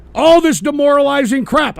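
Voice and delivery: ranting at his viewers, driving the point home loudly; emphatic loud repeat. Real, not acted. Repeats the line to hammer it.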